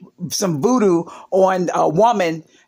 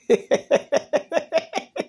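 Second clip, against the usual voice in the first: a burst of high, breathy laughter; real laughter, high and light; 9 semitones higher, 4 dB quieter than usual. Real, not acted. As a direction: crack up at the punchline. Laughing at her own story.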